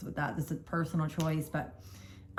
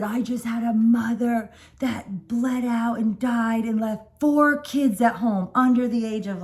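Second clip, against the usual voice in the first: retold angry doctor; 4 semitones higher, 5 dB louder, slower than usual. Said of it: "he's not calming down".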